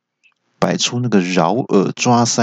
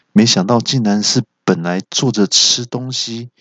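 usual speaking voice

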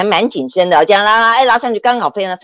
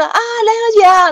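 high, slow, drawn-out friendly greeting; professor's greeting; 3 dB louder than usual. Retold or imitated. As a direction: greet her warmly, much higher and slower. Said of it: "他就跟我说". (热情教授的招呼)

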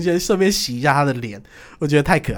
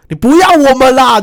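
high, loud mock outrage; hammed-up indignant yell, pitched high and loud; mock outraged shout; 11 semitones higher, 12 dB louder than usual. Shouting at mainland officials.

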